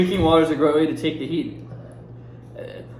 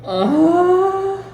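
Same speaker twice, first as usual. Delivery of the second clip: much higher, panicky pleading; panicked; 14 semitones higher, 5 dB louder, much slower than usual. Real, not acted. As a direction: whine it out in real panic. About to eat the Reaper bean.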